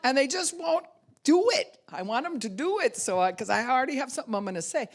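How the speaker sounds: high, fast exasperated rant; frustrated venting. Retold or imitated. Someone whose plan is ignored, exasperated rant. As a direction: blurt it out high and fed up.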